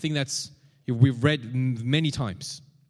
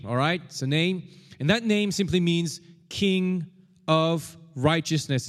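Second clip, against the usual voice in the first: normal talking voice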